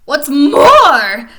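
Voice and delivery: loud, high snap; angry loud snap; snapped. Acted. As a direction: bite the words off angrily. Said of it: "snapped the Lorax".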